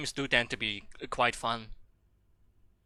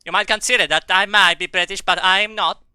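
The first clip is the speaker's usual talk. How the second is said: high, loud put-on British accent; mock British accent; 7 semitones higher, 10 dB louder than usual. Streamer mocking chat in a British accent. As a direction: ham up a cheeky Brit.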